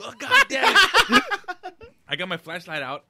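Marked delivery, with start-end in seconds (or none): 0.0-1.3 slow, much higher, louder quote through laughter; laughing mock groan. Laughing as he quotes himself fleeing a ghost. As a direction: groan it while cracking up. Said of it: "Navy sailors running around".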